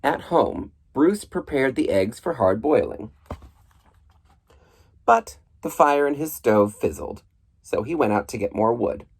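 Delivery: ordinary delivery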